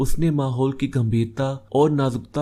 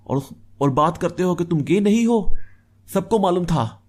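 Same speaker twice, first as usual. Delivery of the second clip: high-pitched, indignant argument; heated accusation; 7 semitones higher, 4 dB louder than usual. Acted. Slapped drunk friend challenging both men over marriage, heated. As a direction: throw the accusations back in a raised voice. Friend argues back after slap.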